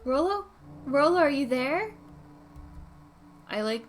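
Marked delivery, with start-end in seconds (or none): 0.0-1.9 higher, louder call. Acted. Luca calling for Rolo, loud calling. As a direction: call out into the dark.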